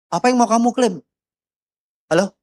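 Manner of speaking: usual speaking voice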